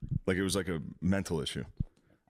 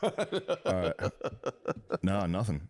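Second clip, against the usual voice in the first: usual speaking voice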